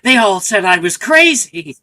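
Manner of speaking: building to a loud villain cry; hammed-up evil genius; mad scientist rant. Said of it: "the evil genius that got rejected".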